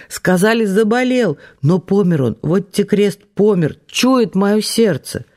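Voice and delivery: high, broken wailing cries. A narrator's reading. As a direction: keep wailing, sure he's dead. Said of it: "в её обрывчатых воплях". (надрывный вой)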